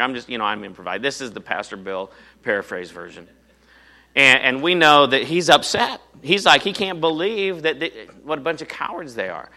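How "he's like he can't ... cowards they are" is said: ordinary talk, nothing marked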